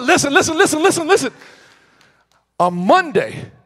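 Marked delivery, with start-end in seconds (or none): 0.0-1.3 high, laughing repeated listen; rally the crowd through a laugh; excited call for the crowd's attention. Real, not acted. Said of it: "calling the crowd".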